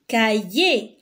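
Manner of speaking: cahier drawn out as ka-yé; two slow syllables in cahier, no R heard; silent final R. Shown as a demonstration. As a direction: say cahier slowly, ending on é. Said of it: "c'est le son E".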